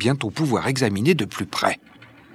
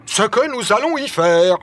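high, heated echo of the question; stamping anger; 9 semitones higher, 4 dB louder than usual. A narrator's reading. Barbicane stamps his foot at Nicole's question, angry. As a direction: throw the question back angrily. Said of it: "répond Barbicane en frappant du pied".

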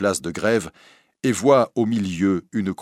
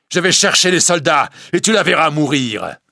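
loud, high, urgent threat; vengeful shout; 9 semitones higher, 7 dB louder than usual. Acted. Priest leaving for soldiers, shouting threats.

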